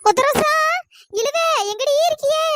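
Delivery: high, loud searching call. Acted. Searching the bungalow for missing friends, calling out. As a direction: holler for a lost friend, stretching the names.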